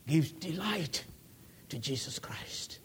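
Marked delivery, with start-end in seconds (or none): 1.7-2.8 a sudden reverent hush; whisper. Real, not acted. Preacher to congregation, hushed whisper.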